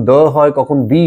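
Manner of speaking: first the as "duh", second the as "dee"; "thuh" versus "thee". Shown as a demonstration. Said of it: "এই ছোট ছোট উচ্চারণগুলোকে".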